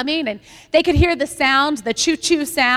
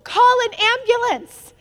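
retold yell; 9 semitones higher, 3 dB louder, slower than usual. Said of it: "they got".

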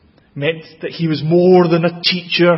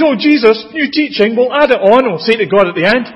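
higher, louder, breezy and careless; flippant mock voice; 8 semitones higher, 5 dB louder than usual. Imitating churchgoers treating Jesus as a teacher, breezy mock tone. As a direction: brush it off lightly. Mocking people's casual attitude.

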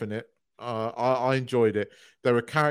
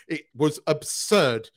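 slow then a loud shout on good; excited gush; 3 semitones higher, 5 dB louder, slower than usual. Real, not acted. Fan raving about Top Gun, loud excited shout. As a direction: build to a loud cry of joy.

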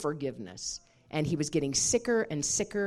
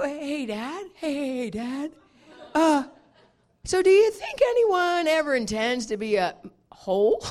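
higher-pitched, haltingly working up to the question; retold shocked hesitancy; 7 semitones higher, 4 dB louder than usual. Daughter nervously asking her dad the forbidden question, in retold shock. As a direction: stall on the greeting, then ask it high. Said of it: "I'm so in shock".

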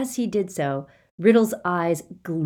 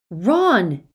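loud call; shout; 2 semitones higher, 6 dB louder than usual. Acted. Harry shouts Ron's name to snap him out. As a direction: shout his name to break the spell. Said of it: "he shouted".

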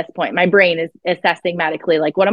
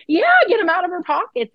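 urgent order; 11 semitones higher than usual. Retold or imitated. Urgent command to sister.

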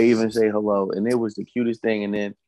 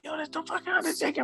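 hushed, high, starstruck whisper; 6 dB quieter than usual. Retold or imitated. Brothers spotting the Undertaker at the airport, awed whisper. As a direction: breathe it out in awe.

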